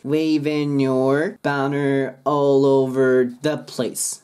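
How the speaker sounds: slow "Waving your" as "waven-nyor"; linked N. Shown as a demonstration. Teacher demonstrating the link, slow and joined. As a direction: stretch "Waving your banner", G gone, N carried over. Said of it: "Nの音で乗っかかります".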